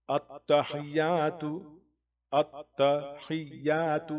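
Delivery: التحیات recited slowly, each syllable clear; recited Arabic. Shown as a demonstration. Teacher models التحیات for students to repeat. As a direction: pronounce التحیات carefully for students to copy. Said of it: "میری آواز کو follow کریں".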